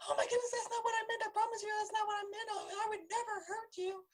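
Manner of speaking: high, quick, whiny, on the verge of fake tears; mock tearful plea. The offender turns to tears, hammed-up high weepy pleading. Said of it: "one of the major ways is crying".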